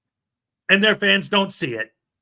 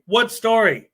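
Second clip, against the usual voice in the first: ordinary talk, nothing marked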